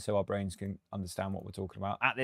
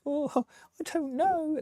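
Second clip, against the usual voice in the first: high, drawn-out mock dithering; mock unsure; much slower than usual. Producer imitating artists who doubt their music, mock unsure. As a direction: put on a wavering, hesitant artist, much higher and slow. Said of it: "very few of them are like".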